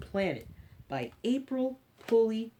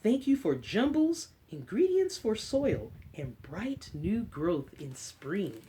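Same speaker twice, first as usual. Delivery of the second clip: put-on reading to children. Librarian reading an Earth book to kids, storybook voice. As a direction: read it gently to a class of little ones.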